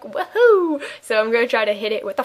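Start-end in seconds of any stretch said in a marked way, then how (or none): 0.0-0.8 mock cheer. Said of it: "We're like".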